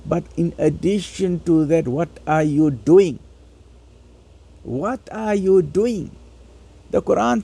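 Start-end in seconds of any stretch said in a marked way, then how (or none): none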